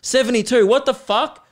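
loud, high-pitched exasperated outburst; getting angry. Real, not acted. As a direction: react in disbelief to a climbing number. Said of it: "because I'm getting angry".

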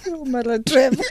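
high-pitched, laughing protest; helpless amusement. Real, not acted. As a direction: squeal it through laughter. Cracking up at comedian's story.

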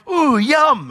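high, loud, greedy little yum; mock eager delight. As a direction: playfully pounce on a tempting treat. Acts spotting the tempting carrot.

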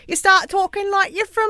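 lifting into a teasing upper-class British voice; mock English accent. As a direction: put on a jokey London posh accent. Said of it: "you start mocking, accidentally mocking people's accents".

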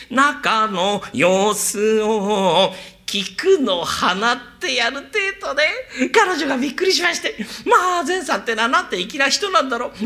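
high, laughing woman's voice; giggling girl. Retold or imitated. Geisha swooning over Zen-san, laughing high. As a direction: gush his name between giggles.

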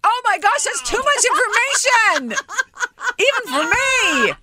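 very high-pitched squealed protest; scandalised, voice shooting way up; mock-horrified outburst. Real, not acted. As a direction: shriek in amused dismay. Co-host reacting to too much information.

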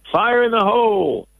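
put-on high, excited little kid's yell; a four-year-old calling the alarm; imitating child yelling. Host retelling, imitating four-year-old yelling about a fire. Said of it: "the four-year-old started yelling".

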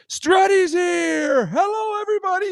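long drawn-out, high-pitched holler; loud Struddy shout. Retold or imitated. Storyteller imitating a loud friend entering a casino, high drawn-out shout. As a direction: bellow a big hello to the whole room. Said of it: "you know how loud Struds can get".

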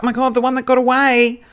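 high-pitched mock gushing; acted-out high, gushy exclamation. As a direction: gush it in a high, sustained voice. Acting out her gushing message.

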